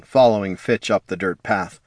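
plain reading voice

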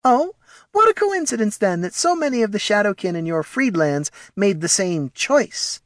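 very high, mock-innocent voice; smug, high-pitched little kobold; snide kobold Morty. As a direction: needle a rival in a tiny prim voice.

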